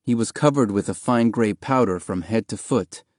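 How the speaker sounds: ordinary delivery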